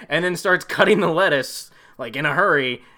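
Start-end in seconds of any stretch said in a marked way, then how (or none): none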